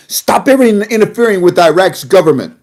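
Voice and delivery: retold angry shout, easing off at the end; imitated yell. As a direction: imitate a politician yelling his demand. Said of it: "he was yelling and screaming at the Iranians".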